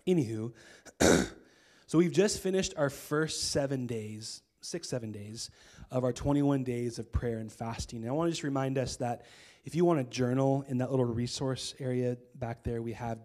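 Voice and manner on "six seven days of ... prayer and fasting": ordinary talk, nothing marked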